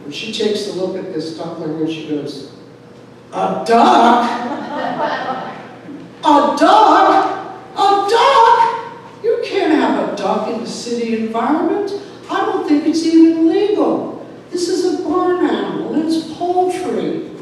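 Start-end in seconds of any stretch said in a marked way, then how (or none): 3.3-9.2 high, loud, aghast disbelief; incredulous mother. Retold or imitated. Mother balking at the duckling, incredulous. 9.2-17.3 high, loud, indignant protest; objecting mother. Retold or imitated. Mother refusing a duck in the city, indignant.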